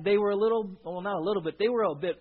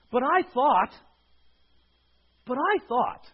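high, raised protest, easing on the repeat; let-down protest; 6 semitones higher, 6 dB louder than usual. Retold or imitated. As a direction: object like someone badly let down. Voicing let-down people's protest.

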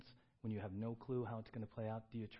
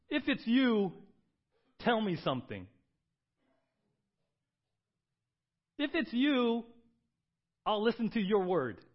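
much higher and louder, pleading; Peter's bold cry; 10 semitones higher, 13 dB louder, slower than usual. Retold or imitated. Peter calling to Jesus, high and loud with faith. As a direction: cry out in full trust.

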